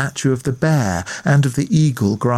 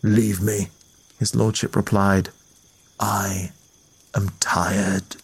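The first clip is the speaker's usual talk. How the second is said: weary lord; 5 semitones lower, 4 dB quieter than usual. A narrator's reading.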